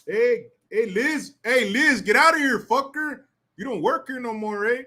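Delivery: taunting Liz like a neighborhood homie; mock homie taunt. Mocking Chicano homie telling Liz off.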